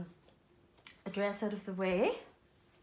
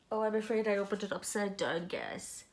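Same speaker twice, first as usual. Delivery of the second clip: usual speaking voice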